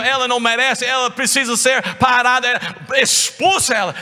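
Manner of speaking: irritated disciples. Acted. Disciples complaining to Jesus about the woman, irritated. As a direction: demand she be silenced. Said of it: "eles ficaram irritados com ela".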